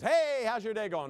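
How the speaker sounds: loud, high, stretched "hey"; big mock-friendly hello; cheery hallway greeting. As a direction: call out to a colleague, hammed up. Acting a teacher greeting in hallway.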